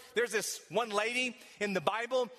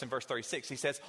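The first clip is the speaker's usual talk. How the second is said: usual speaking voice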